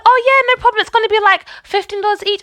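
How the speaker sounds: imitated friend, high and airy; high put-on "no problem" voice; breezy friend. Mimics friend's breezy assurance.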